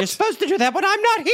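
high, loud scandalized mother's "Excuse me?"; indignant mother. Retold or imitated. Mother scolding card-playing sons, high indignant voice. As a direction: play the offended mother catching them. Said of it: "And the mother was right there like".

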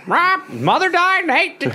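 parrot squawk. Pretend. Caged parrot on the porch squawking crude lines, high parrot squawk.

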